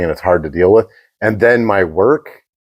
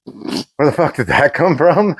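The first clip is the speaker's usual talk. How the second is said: retold confusion; 6 semitones higher than usual. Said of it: "And I'm like".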